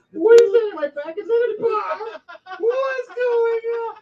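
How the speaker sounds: high, loud, hysterical cackling voice; laughing spider queen. Acted. Dying spider queen mocking the bomb, shrieking with laughter. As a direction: shriek it through manic laughter. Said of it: "starts chuckling and laughing, going".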